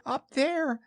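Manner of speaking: high little piglet squeak; squeaky frightened small animal; scared Piglet. Acted. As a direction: point out danger in a tiny, high voice. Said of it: "said Piglet".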